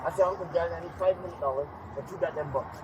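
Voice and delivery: ordinary delivery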